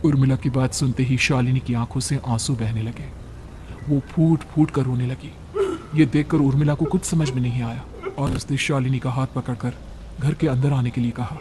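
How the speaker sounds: usual speaking voice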